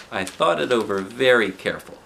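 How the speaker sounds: ordinary delivery, nothing marked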